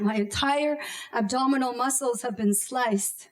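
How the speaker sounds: ordinary delivery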